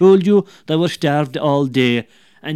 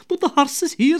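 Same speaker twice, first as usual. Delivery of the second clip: much higher, pleading woman's voice; worried wife; 12 semitones higher, 4 dB louder than usual. Acted. Wife pleading with the neighbour, high and anxious.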